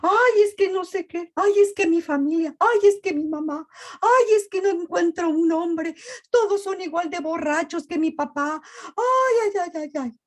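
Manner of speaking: high, whining complaint that slides into sobbing; mock a grown woman who still cries over everything; whiny sufferer. Mocking the daughter who still cries at twenty-five, high whine. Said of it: "y sigue llorando".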